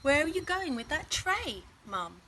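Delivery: put-on high, eager little girl; Laura, high and curious; child's voice. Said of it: "asked Laura".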